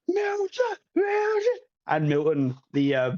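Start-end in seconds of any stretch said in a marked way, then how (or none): 0.1-1.6 high cheering calls; cheering crowd. Acted. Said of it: "everyone's, like, cheering for".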